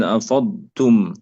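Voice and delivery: أفضتم with ض merged into ت; أفضتم with no separate ض sound; wrongly merged dad. Shown as a demonstration. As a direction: run the ض of أفضتم straight into a doubled ت. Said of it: "يدغم الضاد في التاء".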